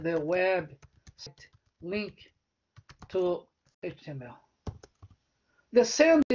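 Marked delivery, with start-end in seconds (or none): 0.0-4.4 slow dictation. Shown as a demonstration. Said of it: "né?".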